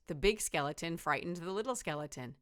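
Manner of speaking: plain reading voice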